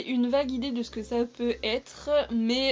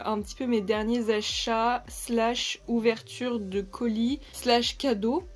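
normal talking voice

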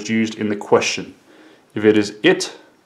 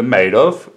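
MADE OF with the voice going up; rising intonation. Shown as a demonstration. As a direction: lift OF at the end like a question. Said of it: "it goes up, my voice".

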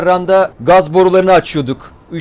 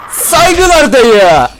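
very slow, loud, higher drawn-out shout; shouted salute; 9 semitones higher, 7 dB louder, much slower than usual. Real, not acted. Gamer hailing a zombie he knocked down, long drawn-out shout. Catchphrase shouted after kill.

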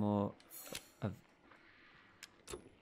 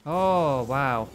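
startled loud exclamation; 2 semitones higher, 10 dB louder than usual. Real, not acted. Player startled by the monster, loud shocked exclamation. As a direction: blurt it out in shock.

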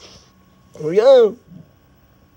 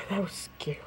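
breathed out in a whisper; relieved whisper; 9 semitones lower, 17 dB quieter, faster than usual. Real, not acted. After the scare, whispering to the others in relief. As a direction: let the fright go in a hush.

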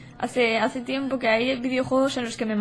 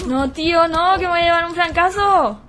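loud, high panicked protest; panicked shouting; 5 semitones higher, 6 dB louder than usual. Real, not acted. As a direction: yell no as you get flanked. Dying in game, panicked.

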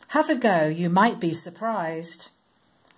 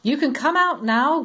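higher, louder call; God calling out; 7 semitones higher, 4 dB louder than usual. Acted. God calling Noah out of the ark, higher and louder. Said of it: "God called to Noah".

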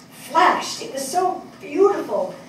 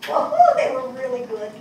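delighted; 13 semitones higher, 3 dB louder than usual. Real, not acted. Admiring the tango dancers.

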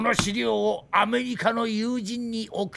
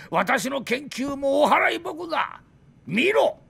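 indignant outburst; 7 semitones higher than usual. Acted. Drunk scientist flares up showing plans.

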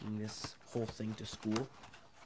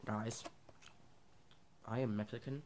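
talking with food in mouth; slightly muffled chewing speech; chewing while talking; 3 dB quieter than usual. Real, not acted. Seen 3: Red food put in mouth at -7; cheeks bulge, jaw chewing to ~3.9s.

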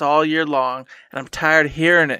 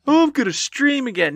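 mock boast; 9 semitones higher than usual. Mocking past self's boast.

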